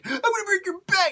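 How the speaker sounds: hammed-up screaming threat. Bane taunting Batman's dad, high mock yell. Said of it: "I'm just gonna be like".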